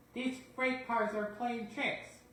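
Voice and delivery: high, slow worn-out complaint; breathless and exasperated; panting. Acted. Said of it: "panted James".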